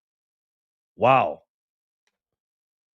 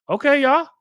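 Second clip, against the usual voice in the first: excited okay; 14 semitones higher, 5 dB louder than usual. Real, not acted. Excited reaction to viewer.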